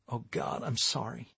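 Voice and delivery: hushed, softly gasped apology. A narrator's reading. Savannah hears that the children passed away, gasping softly. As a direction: drop to a soft, shocked gasp. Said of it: "gasped Savannah".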